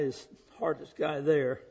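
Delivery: ordinary talk, nothing marked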